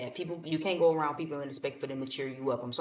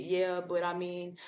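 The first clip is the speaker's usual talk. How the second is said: slow, higher whiny mock lament; imitating a gloomy single complaining; mopey Eeyore; 4 semitones higher, slower than usual. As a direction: moan like nothing will work out. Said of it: "Here you go with the Eeyore syndrome".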